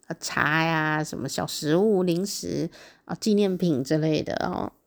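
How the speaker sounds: ordinary talk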